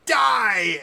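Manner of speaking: slow, high, loud heroic declamation; play-acting kid hero. Kid finishing off playmate in pretend duel, mock-heroic. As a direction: build grandly to a shouted final blow. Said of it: "And the kid goes".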